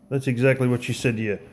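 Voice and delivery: normal talking voice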